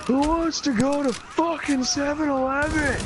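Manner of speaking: high, slow hollered invitation; friend's loud yell. Retold or imitated. Friend rallies the party for store run, high drawn-out yell. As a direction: imitate bellowing to the whole room. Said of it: "yelled as loud as he possibly can".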